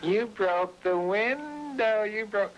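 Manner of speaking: high, slow mock yell, pitched well up; imitating Linda hollering. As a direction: stretch it out high like a drunk girlfriend scolding. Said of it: "And she says".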